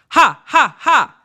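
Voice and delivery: loud, high, separate "ha" bursts; pretend laugh. Shown as a demonstration. Voice coach demonstrating a diaphragm exercise, pretend laugh. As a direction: punch out each mock-laugh ha from the belly. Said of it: "pretend you were gonna laugh".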